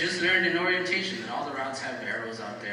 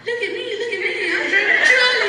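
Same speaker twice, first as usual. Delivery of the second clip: imitating Kathy's gleeful shout, much higher and loud at the end; mock showoff; 7 dB louder than usual. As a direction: crow it like a prankster on the loudspeaker. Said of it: "She goes".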